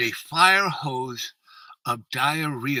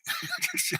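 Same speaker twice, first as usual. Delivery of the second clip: ordinary talk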